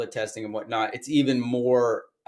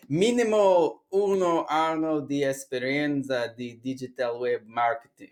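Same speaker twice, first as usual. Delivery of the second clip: slow, drawn-out fake Italian accent; terrible mock Italian accent; 2 semitones higher than usual. Course teacher reading an Italian job ad, terrible Italian accent. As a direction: read it out in a hammy, bad Italian accent. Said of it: "that's a terrible accent".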